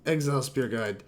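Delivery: ordinary talk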